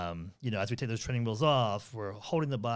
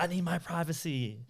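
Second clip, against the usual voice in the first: high-pitched mock indignation; indignant sister; 7 semitones higher than usual. Sister to brother hanging round her room, mock indignant. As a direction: whine it like a put-upon older sister.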